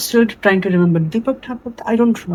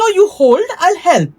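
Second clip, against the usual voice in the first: raised-pitch mock insisting; retold insistence; 8 semitones higher than usual. Senior telling the junior to hold, retold insistence. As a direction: insist briskly, quoting someone else.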